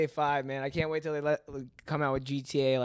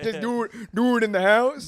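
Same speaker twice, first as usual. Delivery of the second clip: high, loud, fast carefree parent, laughing through it; 9 semitones higher, 10 dB louder than usual. Retold or imitated. Easygoing parent giving the kid free rein, loud, high and laughing. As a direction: rattle it off like a dad who doesn't care. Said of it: "I can hear it coming out".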